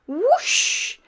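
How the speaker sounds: high, loud sledge whoosh; excited whoosh. A narrator's reading. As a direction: swoop up on the whoosh. Sledge pushed off.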